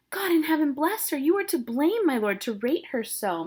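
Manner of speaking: pleading nurse. Acted. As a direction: beg on the girl's behalf. Teacher voicing Nurse scolding.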